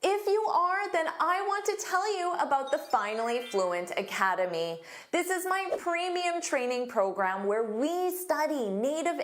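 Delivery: bright, upbeat sales pitch voice; ad read. Acted. Teacher plugging her academy to viewers, upbeat ad read. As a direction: pitch the course like a commercial.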